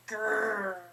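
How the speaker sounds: whispered spooky growl; breathy growl. A narrator's reading. Cat trying to scare friends, whispered growl.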